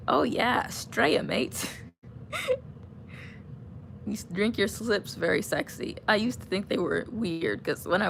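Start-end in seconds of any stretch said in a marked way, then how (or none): 0.7-1.7 light mock Australian accent, drawn out Straya; playing at being an Aussie in an Australian accent; a hint of an Australian bloke. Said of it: "yo, I'm an Aussie".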